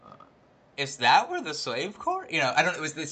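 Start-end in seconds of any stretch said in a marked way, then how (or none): 0.8-2.3 higher, slow sightseer lilt; detached tourist tone. Retold or imitated. Mimicking the old lady on the golf cart, detached curiosity. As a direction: imitate an idly curious tourist. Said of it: "something, this tone that I was like".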